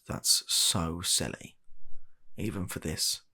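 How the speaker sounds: normal talking voice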